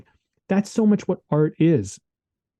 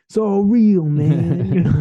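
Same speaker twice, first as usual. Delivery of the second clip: higher, louder laid-back hippie voice; 4 semitones higher, 4 dB louder than usual. Shown as a demonstration. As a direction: play a chilled-out hippie. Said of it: "sound like a hippie".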